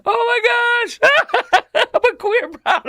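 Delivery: helpless high-pitched laughter through the words; real laughing fit, barely getting words out; laughing hard. Real, not acted. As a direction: squeal it out between laughs. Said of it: "I don't know why I'm laughing so hard".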